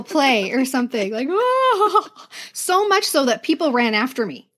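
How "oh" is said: high mock wail like a jilted lover; hammed-up lovesick cry; dramatic wail. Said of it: "wailing like I was some jilted lover".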